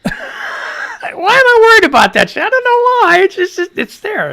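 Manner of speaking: squeaky excited outburst; carried away, voice shooting up high. Real, not acted. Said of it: "Quit getting so excited".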